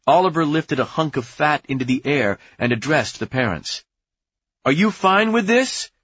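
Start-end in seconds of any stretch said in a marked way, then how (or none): 4.6-5.9 high, rising question to a crowd; challenging call. A narrator's reading. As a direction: put it to the crowd, pitched high. Oliver challenging the parents aloud.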